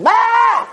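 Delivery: high mock scream; short hammed-up shriek of outrage; freak-out scream. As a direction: yell out in pretend panic. Mimics freaking out over car.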